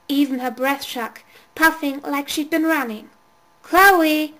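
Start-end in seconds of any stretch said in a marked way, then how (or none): 3.6-4.3 loud call from below. A narrator's reading. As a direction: call her name up the stairs. Babysitter calling down basement.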